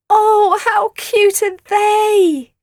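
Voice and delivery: put-on high, slow cooing girl. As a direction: coo over the cute puppies. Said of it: "cooed Nellie".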